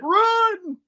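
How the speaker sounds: high squeaky yelp; hammed-up falsetto warning; mock panicked cry. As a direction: squeal it out as if fleeing. Acting Peter yelling to flee.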